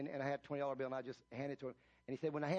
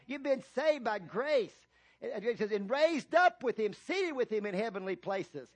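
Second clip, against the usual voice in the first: louder and higher, building sermon cadence; full-force preaching; 6 semitones higher, 6 dB louder than usual. Real, not acted. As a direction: preach it out, stretching key words.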